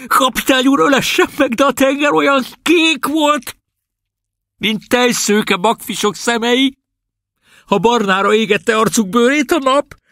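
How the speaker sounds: high, trembling, exultant cry; tremolo enthusiastic cry. A narrator's reading. Prisoner rhapsodizing to the captain, trembling and ecstatic. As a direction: sing out the memory with a quavering voice. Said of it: "feltremolázó, lelkes, kiáltó hangon".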